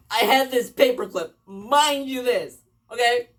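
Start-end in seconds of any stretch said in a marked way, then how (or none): none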